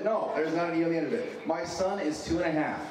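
ordinary delivery

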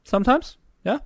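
very high, loud gnome reply. Acted. Gnome answering the farmer about concoctions, high-pitched. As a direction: pipe up in a tiny gnome voice.

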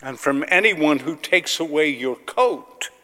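ordinary talk, nothing marked

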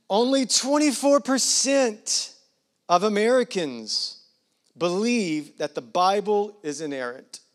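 slow, loud and high start, easing down; big deliberate delivery that settles toward the end; emphatic announcement. Real, not acted. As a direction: proclaim the key figure with weighty pauses. Key statistic announced, then repeated.

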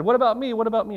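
high-pitched, imitated plea for a turn; eager clamouring. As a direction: pipe up eagerly, mimicking the others. Retold men clamouring for a turn.

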